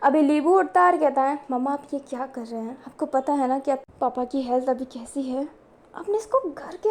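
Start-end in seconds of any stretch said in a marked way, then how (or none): 1.5-6.9 softer, hushed worried questions; speaking quietly. A narrator's reading. Son softly questions his mother for letting the man in. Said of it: "लीबू धीरे धीरे बोल रहा होता है".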